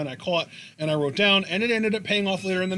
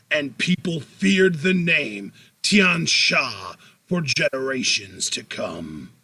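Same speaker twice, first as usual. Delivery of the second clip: movie trailer voice. Acted. Co-host retells the vignette's legend for his podcast partner, movie trailer voice. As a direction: intone it like an epic movie trailer, with weighty pauses. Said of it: "do my movie trailer voice".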